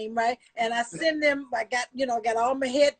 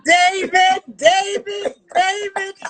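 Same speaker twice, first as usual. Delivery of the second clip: high squealing call of a name; Aunt Ruth voice; 4 semitones higher, 7 dB louder, slower than usual. Retold or imitated. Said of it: "In my Aunt Ruth voice".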